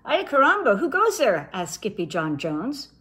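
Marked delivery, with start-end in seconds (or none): 0.0-1.5 character voice. Acted. Character greets strangers.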